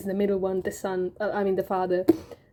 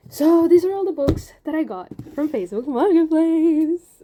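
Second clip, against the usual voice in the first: high, loud burst of excitement; excited; 7 semitones higher, 6 dB louder than usual. Real, not acted. Showing off her new books, excitedly. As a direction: announce the haul with delight. Excited book haul reveal.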